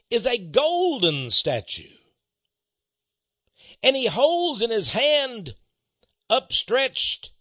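rising pulpit fervour, rolling toward the reveal; preaching build-up. Acted. Radio preacher winds up listeners about the statue, in fervent preaching.

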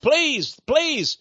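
high-pitched, imitated pleading; begging. Demons begging Jesus to send them into pigs, acted begging. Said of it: "they begged Jesus".

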